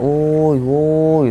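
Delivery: a hint of a distant hail; retold distant call. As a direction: call out woi as if across the forest. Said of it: "Dia teriak".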